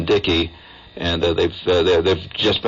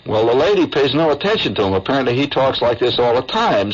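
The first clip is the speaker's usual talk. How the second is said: ordinary talk, nothing marked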